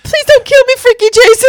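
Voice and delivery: high, loud hammed-up begging squeal; mock pleading. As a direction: imitate terrified begging, cracking up. Acting out film character pleading.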